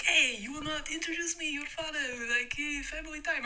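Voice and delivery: high, chummy mock Russian accent; overfamiliar Russian guy. Russian guy with arm round her dad, high mock-Russian voice. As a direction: imitate a Russian muscling into the family. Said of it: "the guy has got his arm around my dad".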